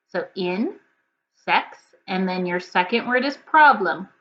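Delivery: usual speaking voice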